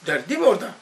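ordinary talk, nothing marked

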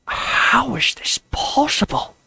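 slow, high, breathless whisper. A narrator's reading. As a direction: hush it so no one outside hears. Turaga asks in secret after guards leave.

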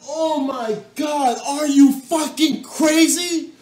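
ordinary talk

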